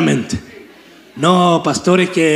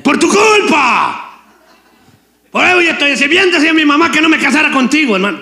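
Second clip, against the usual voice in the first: high, hammed-up angry wife; mock spouse quarrel; 9 semitones higher, faster than usual. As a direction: scold like a nagging spouse at home. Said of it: "el esposo y la esposa le dice". (esposa regañando en tono exagerado)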